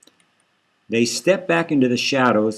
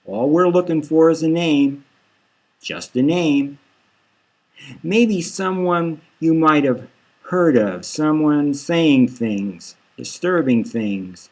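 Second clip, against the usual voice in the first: slow coaxing; 4 semitones higher than usual. Acted. Detective coaxing out a name.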